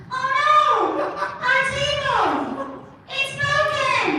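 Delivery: put-on tiny kid voice. Shown as a demonstration. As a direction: squeak it like a tiny child. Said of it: "did you want me to sound like that guy".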